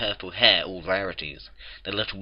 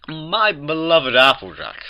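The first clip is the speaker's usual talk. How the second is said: slow, high, loud refined endearment; Rarity; 7 semitones higher, 7 dB louder, slower than usual. A narrator's reading. Rarity greets Applejack in the right accent, grand endearment. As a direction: draw it out grandly in a ladylike accent. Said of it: "now speaking with the right accent".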